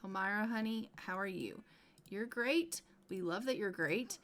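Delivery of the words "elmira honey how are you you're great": ordinary delivery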